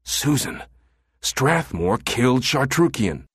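high, slow, hushed plea; lightly whispered desperation; desperate whisper. A narrator's reading. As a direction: urge her desperately to believe. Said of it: "whispered to her in desperation".

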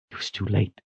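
ordinary delivery, nothing marked

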